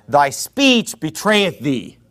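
slow, solemn King James quote; slow solemn Bible recitation; scripture reading. Acted. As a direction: read the verse slowly and weightily. Quoting scripture.

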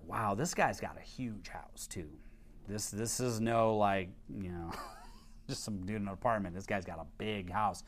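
whispered, low aside about the house; hushed aside. Retold or imitated. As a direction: say it under your breath. Inner aside about the man's house.

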